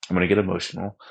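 ordinary talk

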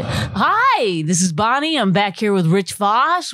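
slow, over-enunciated announcer voice; hammed-up formal radio intro; to someone hard of hearing. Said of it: "No, you don't have to do, talk like that".

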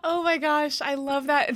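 delighted laugh spilling into the words. Real, not acted. Host laughing with delight at the guest's unlikely love story.

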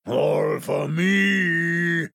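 slow, drawn-out gloating wolf; wolf relishing his prize, drawn out; greedy wolf. Acted. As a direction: savour it slowly like a hungry wolf. Greedy wolf sees the cheese.

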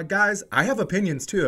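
ordinary talk

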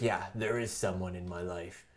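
quiet low mock hero answer; low Leon reply. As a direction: drop it low and hushed, coolly turning her down. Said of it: "It's like".

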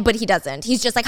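ordinary delivery

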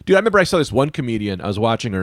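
normal talking voice

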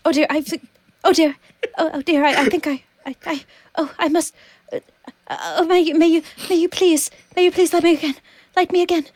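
high, faltering, desperate little voice; pleading, dying candle. Acted. Candle begging the person who lit her, high frantic pleading. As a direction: beg haltingly as if about to be snuffed out. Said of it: "a candle trying to warn the person".

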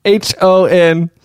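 very slow spelling bee letters; spelling words in front of kids. Pretend. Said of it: "spelling bee".

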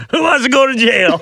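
high mock rally call, laughing; laughing rally cry. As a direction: call out like a hype man. Laughing rally cry after the catchphrase.

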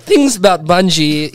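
put-on light Russian accent; a hint of a Russian accent. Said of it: "like, a Russian accent".

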